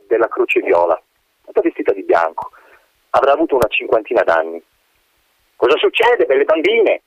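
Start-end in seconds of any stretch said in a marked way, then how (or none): 5.6-7.0 false sweetness. A narrator's reading. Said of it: "chiese sorridendo, ma non era un sorriso simpatico".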